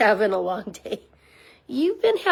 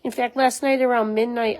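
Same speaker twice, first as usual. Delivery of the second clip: normal talking voice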